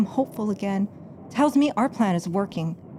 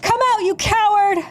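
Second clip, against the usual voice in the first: shouted challenge into the dark, much higher; defiant call; 11 semitones higher, 3 dB louder than usual. Acted. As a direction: yell out to dare him to show himself. Calling out into the dark.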